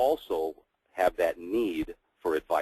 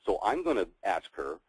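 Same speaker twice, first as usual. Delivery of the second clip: ordinary delivery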